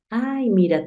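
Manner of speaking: ordinary delivery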